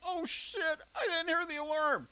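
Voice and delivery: high panicked yell with a cry in it. A narrator's reading. Mother waking to find she's overslept, high panicked yell. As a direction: shriek it out, you've overslept.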